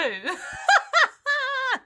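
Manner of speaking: shrieking, high-pitched laugh. Real, not acted. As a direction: burst into a squealing laugh. Reacts to search history.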